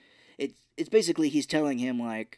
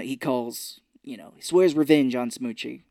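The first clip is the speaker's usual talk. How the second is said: ordinary talk, nothing marked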